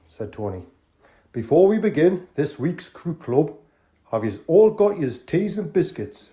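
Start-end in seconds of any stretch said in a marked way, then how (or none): 1.3-6.3 slow, higher, louder broad Geordie; drawn-out Geordie host; Geordie accent. Acted. Tony checking the crooks have tea, Geordie. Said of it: "an authentic, uh, Northerner".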